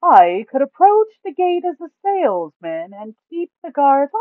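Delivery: high, loud party member pitching a sneaky plan; high, loud character voice. Acted. Character proposes salesman ruse.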